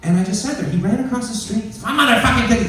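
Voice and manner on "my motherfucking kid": high, loud angry yell; a father shouting over his kid; swearing owner. Retold or imitated. Owner runs across the street yelling at him, furious shout.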